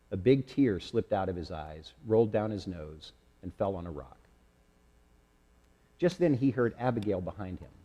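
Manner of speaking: plain reading voice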